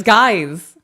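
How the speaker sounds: imitated child's interjection. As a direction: cut in on an argument as the daughter. Daughter breaks up yelling match.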